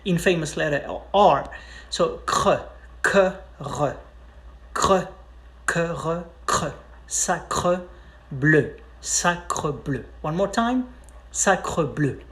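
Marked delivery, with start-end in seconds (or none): 1.9-6.7 "cre" split into "que re" with a French throat R; French R drill. Shown as a demonstration. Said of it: "the cre is crucial here". 7.1-10.0 "sacre bleu" drawn out, French R; slow it right down for learners; slow teacher demo; slow model. Shown as a demonstration. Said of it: "the cre is crucial here".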